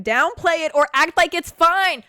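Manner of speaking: high, loud, breaking into a wail; loud outburst. Real, not acted. Creator reading a too-accurate description, loud rising outburst.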